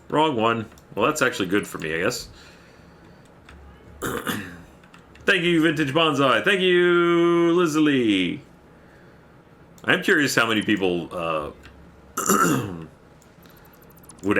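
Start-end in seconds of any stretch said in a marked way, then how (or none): 5.2-8.4 high, loud shout-out with a long held "you"; calling out thanks. Real, not acted. Streamer thanking supporters by name, loud high call-out. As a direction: belt out the thanks, stretching it high.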